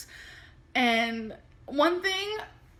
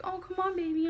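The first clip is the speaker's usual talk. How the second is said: higher-pitched wheedling imitation; cute begging; 7 semitones higher, faster than usual. Imitating the partner's early begs for intimacy, cutely pleading. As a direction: imitate a cute little coaxing plea. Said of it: "like, cute little begs".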